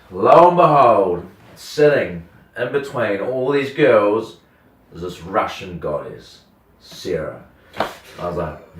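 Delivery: ordinary delivery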